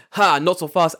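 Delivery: high, loud mock defiance; hammed-up cartoon hero retort; Kaiba. Imitating Kaiba to the guards, loud mock bravado. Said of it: "And Kaiba goes".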